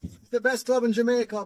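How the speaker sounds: put-on high Jamaican accent; Grim. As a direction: imitate a Jamaican driver's boast, pitched high. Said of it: "He had that Jamaican accent".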